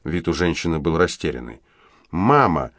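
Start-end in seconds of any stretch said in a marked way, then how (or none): 2.1-2.7 выпалила. A narrator's reading. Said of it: "выпалила Энджел".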